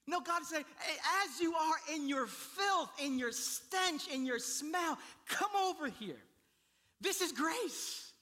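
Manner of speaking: high, loud, impassioned sermon delivery; full-force preaching. Real, not acted. Pastor proclaiming grace to congregation, full-force preaching. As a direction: proclaim it with urgent conviction.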